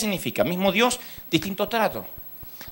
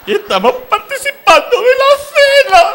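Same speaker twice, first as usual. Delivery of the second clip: mock wailing; 12 dB louder than usual. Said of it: "mocking a weeping church".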